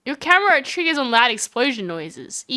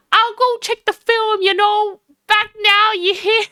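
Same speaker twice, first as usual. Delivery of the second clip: high-pitched, hammed-up Southern twang; bad Southern accent; 10 semitones higher, 4 dB louder than usual. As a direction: play it as an over-the-top Southerner, high. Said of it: "my really bad southern accent".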